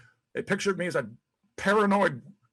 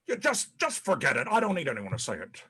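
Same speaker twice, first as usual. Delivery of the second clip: ordinary talk, nothing marked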